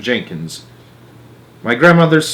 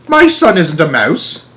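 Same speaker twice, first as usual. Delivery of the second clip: slow, loud indignant denial; 6 semitones higher, 6 dB louder, slower than usual. Acted. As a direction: spell it out in outrage. Jenkins denies son is a mouse.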